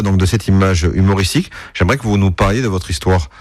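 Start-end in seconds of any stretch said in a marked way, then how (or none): none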